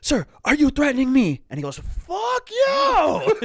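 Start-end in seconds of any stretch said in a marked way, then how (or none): 0.0-1.4 high, loud challenge; hammed-up shouted demand; acted confrontational yell. Acted confrontation in airport scene. 1.8-3.4 very high, loud shriek; hammed-up scream of defiance; acting out a man completely losing it; imitated furious scream. Imitating freaked-out man screaming back.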